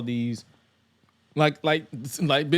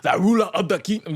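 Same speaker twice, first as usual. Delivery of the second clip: put-on higher, louder TNA-era accent; 7 semitones higher, 5 dB louder than usual. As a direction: slip into the old TNA promo accent. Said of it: "peek back into his TNA accent".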